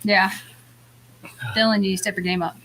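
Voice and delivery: usual speaking voice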